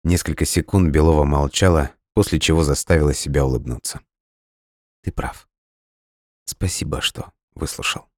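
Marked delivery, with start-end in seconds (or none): 5.0-8.1 soft, hushed gratitude; much softer, subdued thanks; quiet. A narrator's reading. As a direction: say it very softly, almost under the breath. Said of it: "тихо произнесла она".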